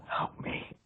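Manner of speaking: barely audible whisper; all breath, hushed so nobody else hears; mouthed plea. Acted. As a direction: whisper a secret plea for help. Player secretly mouths plea to friend.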